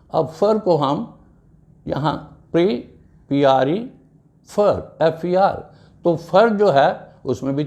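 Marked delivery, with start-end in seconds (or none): none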